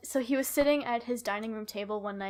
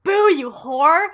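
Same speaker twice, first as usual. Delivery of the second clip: slow drawn-out yell, higher; mock shout; 6 semitones higher, 16 dB louder, much slower than usual. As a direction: belt it like a movie quote. Quoting Mean Girls after boo.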